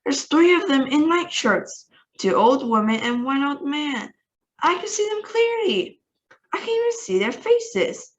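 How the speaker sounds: high, excited report; frantic. Acted. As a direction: rush it out in disbelief.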